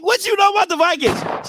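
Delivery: high-pitched mock outrage; indignant disbelief. Dad challenging young daughter's team choice, mock indignant. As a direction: shoot back in incredulous high voice.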